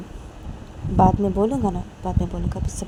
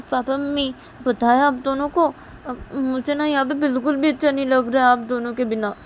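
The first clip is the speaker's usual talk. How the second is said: higher-pitched daughter's plea to her parents; acted plaintive appeal, pitched up; plaintive complaint; 7 semitones higher, 4 dB louder than usual. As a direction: complain in a raised, pleading voice.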